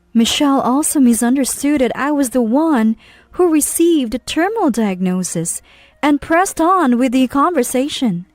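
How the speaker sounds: usual speaking voice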